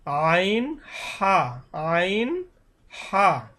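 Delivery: আইন and হা squeezed from mid-throat, held very long; slow, stretched আইন, হা from the middle of the throat; pressed-throat articulation. Shown as a demonstration. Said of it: "গলায় একটু চাপি উচ্চারণ হবে".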